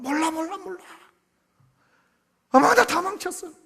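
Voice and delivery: put-on high, whiny voice of a sulking child; sulky child. Pastor voicing a child upset over a test, high whine. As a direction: whine like a kid who flunked a test. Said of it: "이러고 있어요".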